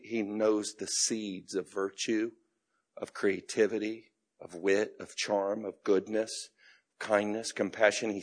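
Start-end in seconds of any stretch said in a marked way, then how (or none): none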